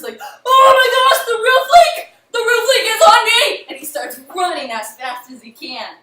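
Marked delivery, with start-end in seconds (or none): 0.2-3.6 high, very loud panicked scream; terrified wolf. Acted. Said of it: "the wolf is completely terrified".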